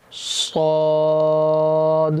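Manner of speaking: صاد slow and thick; heavy saad. Shown as a demonstration. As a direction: draw out a full, heavy صاد. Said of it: "اس کو موٹا". (صاد موٹا اور کھینچ کر)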